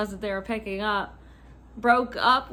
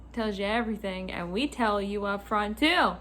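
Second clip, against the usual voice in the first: ordinary delivery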